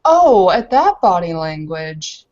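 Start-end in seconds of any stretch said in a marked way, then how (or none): none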